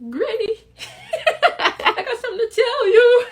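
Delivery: nervous laughing. Retold or imitated. Telling her grandma the big news, laughing and teary. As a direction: blurt it out through nervous giggles.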